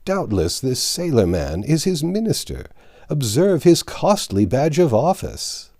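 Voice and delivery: high, mocking taunt; mocking crowd. A narrator's reading. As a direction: sneer it out as the crowd. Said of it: "They said".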